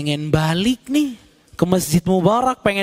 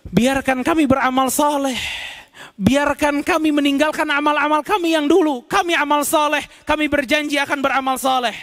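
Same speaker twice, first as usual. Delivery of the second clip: pleading in unison; 7 semitones higher than usual. Acted. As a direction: beg God to get them out. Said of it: "mereka serentak mengatakan".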